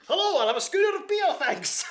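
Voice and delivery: a shopper in the pub hears a booming, high-pitched showman professor; Professor Flint voice. Retold or imitated. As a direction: order a beer still stuck in character as the eccentric professor. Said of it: "I have been known to go into a pub afterwards and say".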